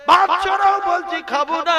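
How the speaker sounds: a stubborn son barking at his mother; angry son shouting. Retold or imitated. Preacher voicing the son pushing his plate away, shouting.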